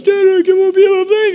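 put-on high, whiny little boy begging his dad; pleading kid voice. Son begging dad to let him play, high whiny plea. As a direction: plead like a disappointed young son.

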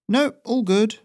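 very high, loud cheery courier; breezy and carefree, up high; Ferrino's high voice. Acted. Messenger answers the local, very high and breezy.

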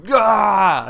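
high, loud mock shriek of pain; hammed-up recoiling shriek; mock vampire shriek. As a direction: shriek like a vampire hit by a cross.

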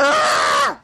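short loud high shout; loud exasperated cry; sudden yell. Real, not acted. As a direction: blurt out a sharp "Ah!".

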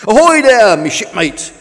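loud, high sailor's hail; calling out. A narrator's reading. Tug greets the new boat at its mooring, calling out. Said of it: "called Tug".

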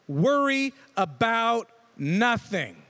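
slow, weighty scripture line; slow emphatic reading. Shown as a demonstration. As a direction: Worry about nothing. drawn out slowly. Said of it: "it says".

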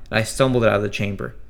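ordinary delivery, nothing marked

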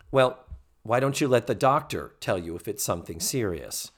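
ordinary delivery

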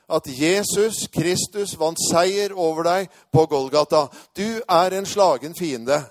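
loud, forceful declaration; shouted proclamation. Retold or imitated. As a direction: proclaim victory loudly over the enemy. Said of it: "Jeg ropte det høyt i hjertet".

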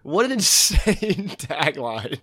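slow words broken by a laugh. Real, not acted. Laughs mid-line.

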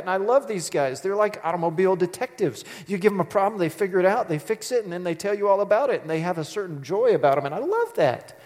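ordinary delivery

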